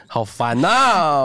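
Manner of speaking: long high drawn-out groan of annoyance; exasperated drawl. Real, not acted. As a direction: stretch it slowly, fed up. Groans at stubborn emcee. (拖长音的不耐烦)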